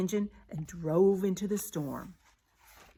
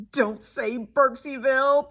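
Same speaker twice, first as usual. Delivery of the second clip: slow, higher begging plea; pleading; 5 semitones higher than usual. A narrator's reading. Shifty begs the radio not to name his town, pleading. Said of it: "pleaded Shifty".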